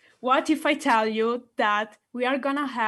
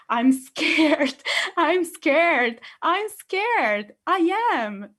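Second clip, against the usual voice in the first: playful mock fear; 6 semitones higher, much slower than usual. Acting fear of public speaking.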